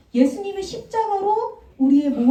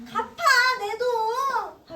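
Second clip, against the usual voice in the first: slow, high, drawn-out demand; angry demand; 11 semitones higher, much slower than usual. Acted. Servant grabbing his friend's collar, slow high demand. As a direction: drag out the demand for money. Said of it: "하면서".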